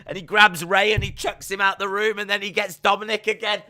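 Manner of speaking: high, loud, rushing action telling; excited storytelling. Real, not acted. Pitching a backstage attack by Veer, loud excited telling.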